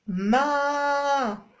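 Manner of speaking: long held ما; drawn-out syllable ma. Shown as a demonstration. Mother modelling the syllable, held long. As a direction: stretch ما evenly. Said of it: "ولات عندي".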